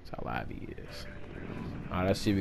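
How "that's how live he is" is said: whisper. Real, not acted. Hushed aside during the match.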